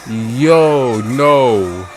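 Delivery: usual speaking voice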